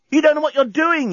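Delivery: high-pitched, desperate yell. A narrator's reading. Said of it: "yelled the journalist".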